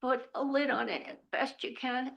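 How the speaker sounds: ordinary talk, nothing marked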